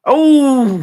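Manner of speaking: sudden high, loud "Oh"; jolted by the price tag; shocked outcry. Real, not acted.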